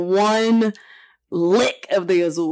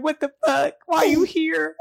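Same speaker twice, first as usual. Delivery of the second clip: shocked surprise; 10 semitones higher than usual. Retold or imitated. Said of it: "I was like".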